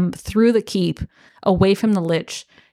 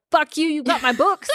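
callous retort; 7 semitones higher than usual. Retold or imitated. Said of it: "And he's like".

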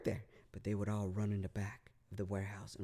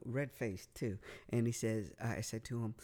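ordinary talk, nothing marked

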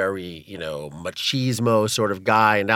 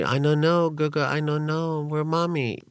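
little sister's voice; 7 semitones higher than usual. Retold or imitated.

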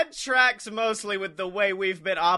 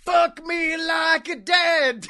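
mock shout; 8 semitones higher, 3 dB louder, slower than usual. Joke shout.

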